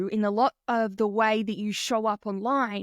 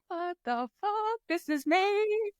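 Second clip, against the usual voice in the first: high, drawn-out, hushed disbelief; slow, small high voice, as if floored; stunned realisation; 7 semitones higher than usual. Retold or imitated. Client recalling being triggered by a post, high hushed disbelief. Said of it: "I was literally there being like".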